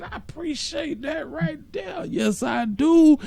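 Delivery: high, slow, drawn-out gratitude; stretched high-pitched appreciation; effusive thanks. Real, not acted. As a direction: sing out your thanks up high. Effusive thanks for a cash donation.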